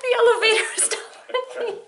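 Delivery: high, tearful laugh through the words; laughing hard. Real, not acted. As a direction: crack up as you say it. Repeating joke, cracking up.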